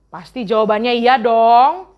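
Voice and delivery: usual speaking voice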